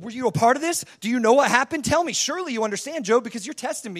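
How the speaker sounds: insistent, taunting interrogation; God grilling Job. Acted. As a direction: keep needling Job for an answer. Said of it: "He'll continue to say".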